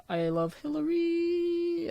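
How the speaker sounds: high, slow silly voice. Acted. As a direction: stretch it out in a goofy high voice. Reads album title in voice.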